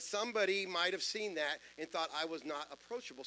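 normal talking voice

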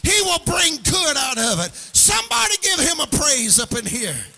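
loud, high, singing preacher's cry to the congregation; full-force preaching. Acted. Preacher whooping to his congregation about God's plan, loud and sung. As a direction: preach it out with a rising, musical whoop.

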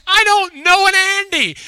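very high, loud exasperated denial; mock exasperated Mama. Mama answers her pestering daughter, exasperated, high and loud. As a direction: imitate a mother at wit's end. Mama losing patience with girl.

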